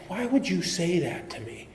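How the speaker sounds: ordinary talk, nothing marked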